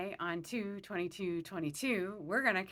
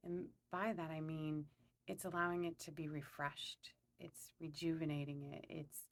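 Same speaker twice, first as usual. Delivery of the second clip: calm, unhurried meditation guide; guided meditation; 2 semitones lower, 6 dB quieter than usual. Acted. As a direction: lead them gently through a visualization, pausing between phrases.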